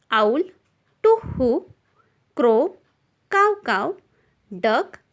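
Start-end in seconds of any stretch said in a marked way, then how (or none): 1.0-1.7 high-pitched spoken owl hoot; teacher's lightly imitated owl call. Shown as a demonstration. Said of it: "makes the sound to who". 3.3-3.9 high-pitched spoken crow call; teacher's lightly imitated cawing. Shown as a demonstration. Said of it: "Crow makes the sound cow cow".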